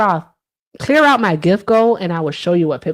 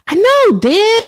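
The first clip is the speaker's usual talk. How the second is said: much higher and louder, calling across the room; 11 semitones higher, 3 dB louder than usual. Real, not acted. Streamer calling to someone in the house about pickle time. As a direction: shout over to someone off mic. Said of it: "I'm sorry you had to, had to hear that".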